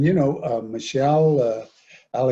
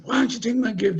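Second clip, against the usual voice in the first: retold yell; 11 semitones higher than usual. Yelling at his brother over the refused gift, higher mock yell. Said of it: "I'm yelling at him".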